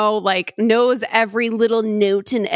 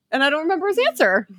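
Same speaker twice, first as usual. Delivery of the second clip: ordinary talk, nothing marked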